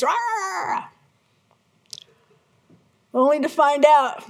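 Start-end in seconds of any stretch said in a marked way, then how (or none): 0.0-0.8 high, raw mock roar; wife losing it on the phone, roaring; hysterical roar. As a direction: let out a frantic roar. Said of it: "It's very dramatic and I'm hysterical".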